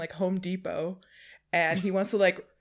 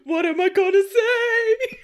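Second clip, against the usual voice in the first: hammed-up imitation of someone at a loss; mock panicked co-host; 10 semitones higher, 6 dB louder than usual. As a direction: whine it in mock helplessness. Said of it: "staring at me ... just like".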